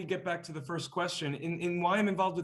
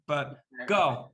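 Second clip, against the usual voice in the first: ordinary delivery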